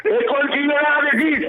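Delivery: high furious shout; angry yelling. Real, not acted. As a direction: bellow it defiantly. Caller yelling defiant slogan.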